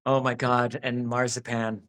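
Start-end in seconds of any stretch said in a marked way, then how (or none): none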